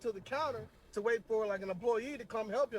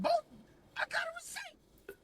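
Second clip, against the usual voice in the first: incredulous squeak. Retold or imitated. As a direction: squeak out the protest in disbelief. Narrator protesting to employee.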